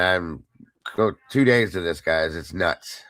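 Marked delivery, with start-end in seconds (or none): none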